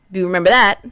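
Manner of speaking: usual speaking voice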